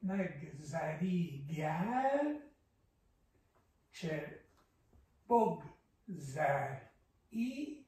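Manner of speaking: Neg, zari, gar, Che, bog, zar, i split apart with pauses; scanning syllables. Shown as a demonstration. Teacher marking short and long syllables for a student. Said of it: "Short, long, short, long".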